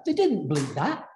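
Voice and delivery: ordinary talk, nothing marked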